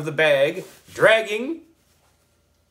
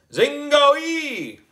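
higher still, drawn-out loud spell word; wizard voice; 13 semitones higher, 3 dB louder than usual. A narrator's reading. Boy wizard repeating the magic word, even higher wizard call. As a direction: ring out the spell again. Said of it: "I said it a second time".

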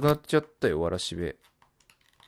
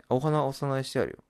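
usual speaking voice

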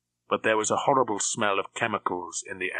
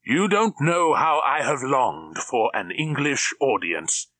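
slow, louder, grand villain speech; orating villain; 5 semitones higher, 4 dB louder, slower than usual. A narrator's reading. Drax relishing an English audience, orating grandly. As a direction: address them like a press conference. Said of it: "as if addressing a press".

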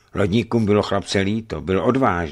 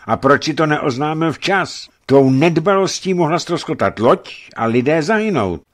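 loud, raised accusing voice; hard interrogation; 8 semitones higher, 5 dB louder than usual. A narrator's reading. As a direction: press him hard about his negligence. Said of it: "pokračoval Trufan Fjodorovič tvrdým hlasem". (tvrdý, vyčítavý výslech)

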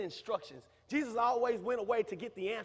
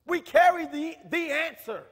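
emphatic preacher's urging, louder; preaching; 2 semitones higher, 6 dB louder than usual. Acted. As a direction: push them to go seek God. Urging congregation to seek God.

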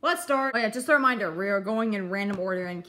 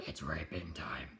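whispered quote; 14 dB quieter than usual. Retold or imitated.

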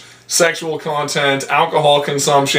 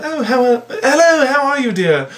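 high-pitched genteel English greeting; mock British accent; 9 semitones higher than usual. English stranger greeting the visiting American, mock British accent. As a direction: put on a much higher English accent. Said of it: "in England".

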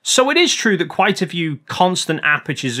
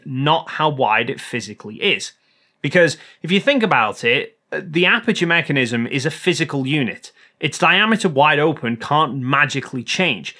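ordinary talk, nothing marked

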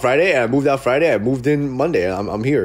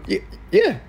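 hesitant landlord yeah; 9 semitones higher than usual. Retold or imitated.

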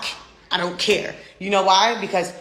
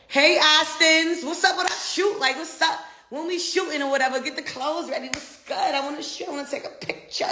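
high playful shout-out; 8 semitones higher than usual. Pretend. Calling a friend to shoot pictures, high teasing voice. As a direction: call out high and sing-song, fading to near whisper.

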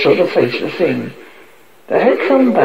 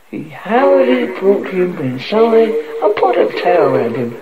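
ordinary delivery

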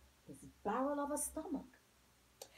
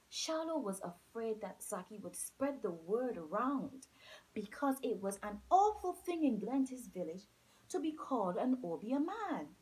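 ordinary delivery

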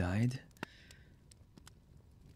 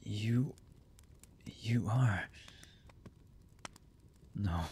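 soft, half-whispered disbelief; stunned recognition; 7 dB quieter than usual. Acted. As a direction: falter softly as recognition sinks in. Stunned on seeing the necklace.

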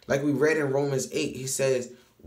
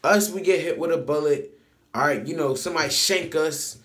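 usual speaking voice

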